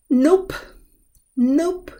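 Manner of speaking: high, loud nope, nope; firm refusal. Shown as a demonstration. Teacher showing a dismissive nope. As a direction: shut it down flatly. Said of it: "which means no".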